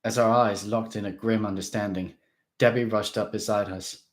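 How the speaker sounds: plain reading voice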